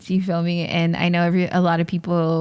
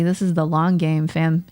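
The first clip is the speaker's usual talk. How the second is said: ordinary delivery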